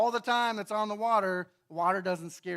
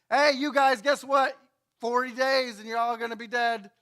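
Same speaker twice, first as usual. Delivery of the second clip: loud, higher, careless warning to the town; mock doom call; 4 semitones higher, 7 dB louder than usual. Jonah warning Nineveh without empathy, loud careless doom call.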